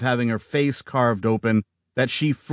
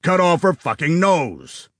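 angry order; 6 semitones higher than usual. A narrator's reading. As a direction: bark the order in rage. Augustus orders the attack.

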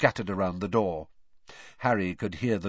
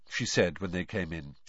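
ordinary delivery, nothing marked

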